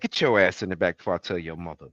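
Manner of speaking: low, quick mock scolding from a big cousin; lightly voiced scolding relative, rushed. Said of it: "It was like".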